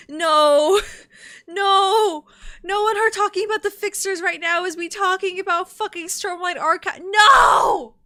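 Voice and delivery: high, shouted wail of denial; loud dismayed protest. Real, not acted. Streamer realizing she rambles like the character, shouted denial. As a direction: shout no in comic despair, drawing it out.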